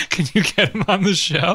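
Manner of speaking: shouted through laughter; laughing. Real, not acted. Laughing while asking, excited.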